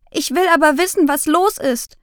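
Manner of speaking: put-on a child shouting back, wanting answers; calling back. Girl calls back to her mother, high and defiant. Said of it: "habe ich zurückgerufen".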